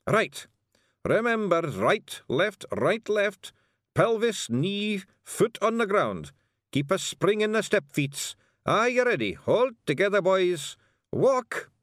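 high, loud Scots drill calls; Feegle drill sergeant. Acted. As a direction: call the march like a wee sergeant. Rob drilling marching.

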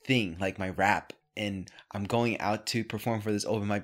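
usual speaking voice

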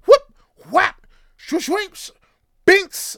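very high, very loud whooshing sound effects; guitar swing noises. Retold or imitated. Swinging his guitar at the attackers, loud high swoosh noises. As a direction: whip the swings out like cartoon hits.

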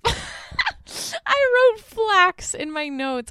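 loud, high, slow laughing confession; real laughter breaking into the words; cracking up. Real, not acted. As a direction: laugh helplessly through your own mistake. Cracks up at own slip.

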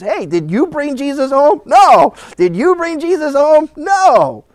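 high-pitched questions, loud answers; anxious searching. Acted. Parents asking relatives for the missing boy, mock-frantic. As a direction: act out a frantic round of asking.

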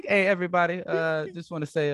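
playful toastmaster opening; mock toast. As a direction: call the room to attention in jest. Mock toast after tapping glass.

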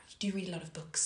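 whispered. Real, not acted. Teasing aside about being a nerd.

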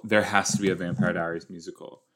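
ordinary delivery

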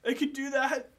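jittery barman answering the detective; nervous bartender Tony. Acted.

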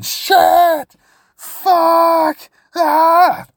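very high, loud mock screams of panic; imitated screaming. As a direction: scream it like he's freaking out on the ride. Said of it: "just yelling, screaming".

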